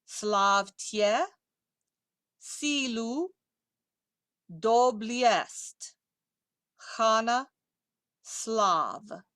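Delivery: slow, deliberate Russian, word by word; slow pronunciation model. Shown as a demonstration. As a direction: leave a clear gap after each word.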